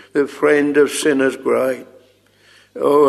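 ordinary talk

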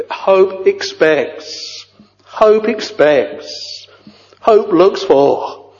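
high and slow, leaning on hope; preacher dwelling slowly on hope for his hearers; slow emphatic. Acted. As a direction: draw out each hope, heavy and high.

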